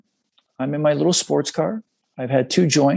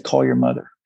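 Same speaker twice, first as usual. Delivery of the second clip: much lower, a calm voice from inside his head; deep inner voice; 14 semitones lower than usual. Retold or imitated. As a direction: speak it low like the little voice in your head. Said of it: "and the voice said".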